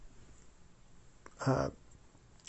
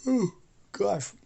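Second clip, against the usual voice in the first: yawning; 5 semitones higher, 3 dB louder than usual. Real, not acted. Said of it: "I'm yawning too much".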